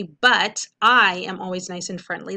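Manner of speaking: emphasising the contrast word; stressing the clue. Shown as a demonstration. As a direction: lean on "But" as the clue word. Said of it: "the word but is a huge clue".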